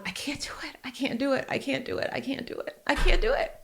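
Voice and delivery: whispered, broken I can't do it; crying whisper. Retold or imitated. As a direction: relive the tearful plea in labor. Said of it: "I was crying".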